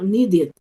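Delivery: NEEDED said as "nee-did"; ID ending. Shown as a demonstration. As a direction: end "needed" on a short I plus D. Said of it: "ese final va a tener el sonido de".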